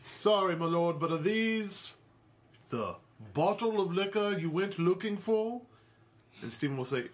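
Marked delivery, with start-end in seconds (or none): 0.0-5.6 deferential servant voice. Acted. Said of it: "And he'll say".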